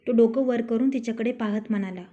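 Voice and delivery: usual speaking voice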